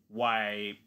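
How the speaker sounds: usual speaking voice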